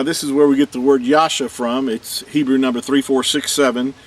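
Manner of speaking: ordinary talk, nothing marked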